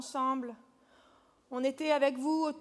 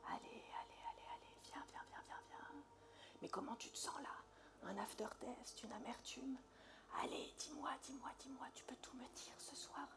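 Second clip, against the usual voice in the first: breathy seductive whisper; 4 semitones lower, 14 dB quieter than usual. Acted. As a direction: whisper it close and intimate.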